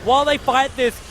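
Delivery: high, loud esports fight call; urgent high-pitched shoutcasting; live play-by-play. Real, not acted. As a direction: call the fight with mounting excitement.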